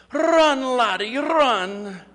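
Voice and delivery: high, loud Scottish shout of "Run, laddie, run!"; Scottish yell. Retold or imitated. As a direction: bellow it like a thrilled Scottish fan. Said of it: "yells at the top of his lungs".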